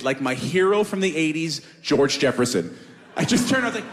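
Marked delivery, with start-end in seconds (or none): none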